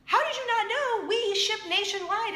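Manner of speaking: high-pitched, animated disbelief; incredulous excitement. Retold or imitated. Friend tells host about shipping, incredulous excitement. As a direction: ask it as if amazed she missed it.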